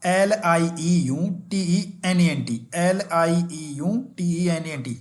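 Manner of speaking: slow dictation of the letters; slow, clear letter dictation; slow spelling out. Shown as a demonstration. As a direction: read the letters slowly, one by one. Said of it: "spelling क्या होती है?".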